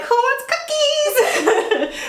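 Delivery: high singsong offer, words drawn out; slow, very high playful call; mock-cheery interruption. Wife breaking up a family argument, mock cheery singsong.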